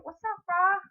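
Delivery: bright greeting. Acted. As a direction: hail a buddy, pitch way up and loud. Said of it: "if you're saying".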